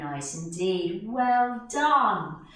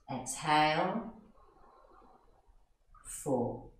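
soft, slow counting of breaths; calm low guided breath count; 4 semitones lower, 10 dB quieter than usual. Shown as a demonstration. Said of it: "making the breath a bit longer".

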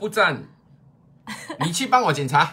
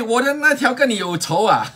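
ordinary delivery

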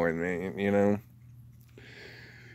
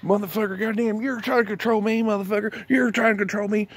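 much higher, fast hammed-up outrage; cartoonish mock fury, high and quick; mock angry ranter; 11 semitones higher, faster than usual. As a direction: rant like a paranoid guy yelling at the government. Mocking anti-government ranters.

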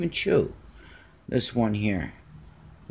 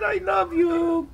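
high cat voice. Pretend. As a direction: announce the cat's words up high. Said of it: "Simba said".

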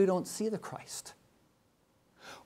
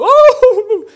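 loud, high mock-startled yelp with a laugh; mock fright; 23 dB louder than usual. Grandpa fakes fright at his grandson's roar, high loud yelp. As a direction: play scared of the little lion.

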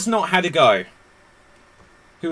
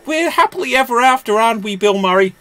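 high, gushing mock-romantic voice; hammed-up heroine; 9 semitones higher, 6 dB louder than usual. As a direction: pretend leading lady at the happy ending. Acts heroine to Bill Murray.

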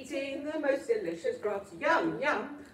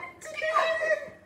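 delighted laugh. Real, not acted. Tickling child.

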